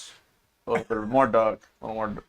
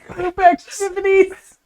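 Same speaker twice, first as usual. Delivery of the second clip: loud announcement; 10 dB louder than usual. Acted. Announcer-style call.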